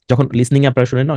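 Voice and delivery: ordinary talk, nothing marked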